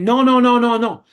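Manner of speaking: loud, insistent string of no's like a security guard; retold shout. Police officer cutting off a reporter at a press conference, loud insistent refusal. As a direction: bark the no's to block someone. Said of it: "comes and starts saying".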